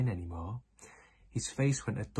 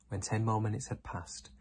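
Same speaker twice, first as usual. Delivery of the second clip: ordinary delivery, nothing marked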